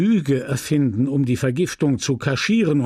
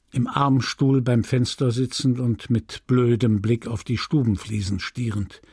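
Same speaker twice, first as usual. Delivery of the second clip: usual speaking voice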